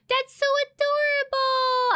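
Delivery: gasp, then high drawn-out squeal; gushing delight. Real, not acted. Streamer seeing a viewer's cute emote, gushing delight. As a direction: gush over it, very high and stretched.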